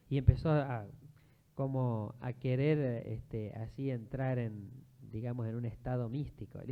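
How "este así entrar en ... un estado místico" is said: usual speaking voice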